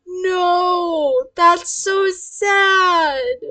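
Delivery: high, very drawn-out sad moan; pitying whine.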